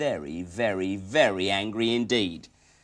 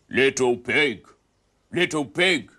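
wolf voice; 6 dB louder, slower than usual. Acted. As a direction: wheedle at the door like a wolf. Wolf at the door.

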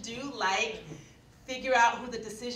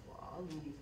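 softer, falling "all" in "We'll all leave"; quiet promise; 4 semitones lower, 10 dB quieter than usual. Retold or imitated. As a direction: give the assurance quietly. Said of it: "We'll leave quietly".

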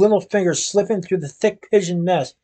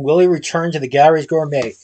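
usual speaking voice

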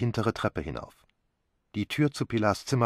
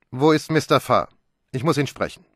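curt demand; 5 semitones higher, 6 dB louder than usual. Acted. Inspector demands Mr Farr.

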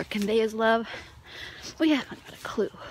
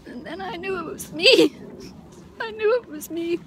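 tearful; 8 semitones higher, 3 dB louder than usual. Real, not acted. [name] realizing the baby was her, crying. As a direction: voice breaking high with real tears. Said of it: "Sorry to be crying so much".

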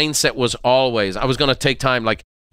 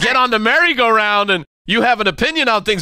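a hint of a teasing wife, higher; mock wife's voice; 9 semitones higher than usual. Husband voicing his wife teasing him about leaving, mock higher voice. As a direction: lightly voice the wife ribbing him.

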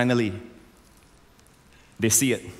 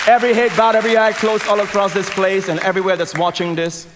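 high, raised voice over the crowd; loud altar call; 7 semitones higher, 7 dB louder than usual. Real, not acted. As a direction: call the whole room to prayer, loudly.

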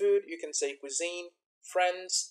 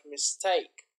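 "mistake" slowly, weight on the second syllable; second-syllable stress. Shown as a demonstration. Teacher gives an example of stress, "mistake" stressed on the second syllable. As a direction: lean on the end of "mistake". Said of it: "the stress is on the second syllable".

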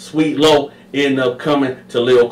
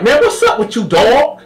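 high, loud, indignant rant; high furious outburst; Sweet Lo angry; 12 semitones higher, 4 dB louder than usual. Retold or imitated. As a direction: turn on him hard. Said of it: "demeanor changed".

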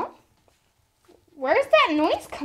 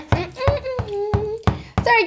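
usual speaking voice

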